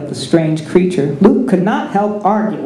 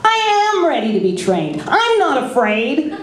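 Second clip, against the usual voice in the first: put-on higher, eager young voice; eager Luke; 8 semitones higher than usual. A narrator's reading. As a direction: insist you're ready, boyishly.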